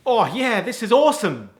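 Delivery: high, acted delight; excited. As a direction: enthuse like a keen new driver. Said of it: "you're, like, so excited".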